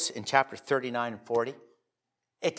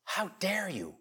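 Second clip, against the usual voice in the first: mock rebuke; 6 semitones higher, 6 dB quieter, slower than usual. Rebuking the disobedient.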